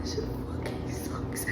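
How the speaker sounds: furious whisper. Real, not acted. Reader whispering a curse at the book, angry. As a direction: hiss the curse under your breath.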